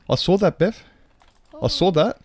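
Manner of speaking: high mock British teasing; a cheeky Brit calling someone out; British accent. Teasing Beth in a mock British accent. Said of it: "that's enough British now".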